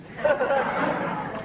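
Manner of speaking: a high real laugh after the punchline. Real, not acted. Speaker laughing at his own joke in front of the audience, high laugh.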